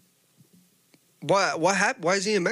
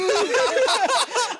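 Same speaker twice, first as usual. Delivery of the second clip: high burst of laughter. Real, not acted. Laughing retelling the encounter.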